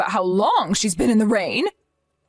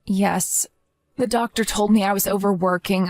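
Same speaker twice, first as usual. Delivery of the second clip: normal talking voice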